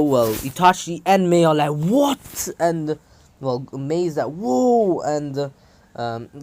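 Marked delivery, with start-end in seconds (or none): 1.7-2.5 high, rising loud disbelief; stunned, can't believe it; shocked what. Acted. Itachi and Mei see Sasuke's power, high shocked question. 4.3-5.0 high, loud amazed gasp of a word; amazed whoa. Acted. Mei watches Sasuke break through, high amazed exclamation.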